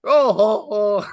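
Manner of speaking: high, loud Santa with an odd foreign accent; hammed-up foreign-accented Santa voice; Santa Claus. Shown as a demonstration. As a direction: greet children in a squeaky, garbled accent. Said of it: "You should hear my Santa Claus".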